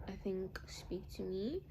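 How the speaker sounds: breathy hushed aside, almost no voice; whisper. Real, not acted. Vlogger recalling scripture, whispering to herself. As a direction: murmur it to yourself. Drops to a hush.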